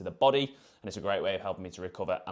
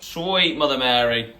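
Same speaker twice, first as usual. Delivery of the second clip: amazed; 4 semitones higher, 9 dB louder, slower than usual. Real, not acted. Amazed at breakfast.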